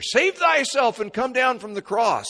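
high, loud jeer; a scornful dare; mocking taunt. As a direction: sneer it up at the cross. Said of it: "they mocked him as the Savior".

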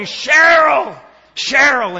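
loud, high drawn-out yell of a name; angry scream. Retold or imitated. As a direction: bellow for your wife across a crowded terminal. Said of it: "he keeps yelling".

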